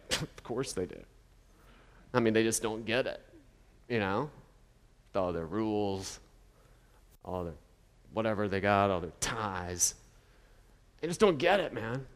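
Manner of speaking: low, soft, world-weary cynic scoffing; a jaded sneer at people who just don't get it; mock cynic. Preacher mocking a cynic who shrugs off critics, low and scoffing. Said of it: "he's like, some sort of countercultural cynic".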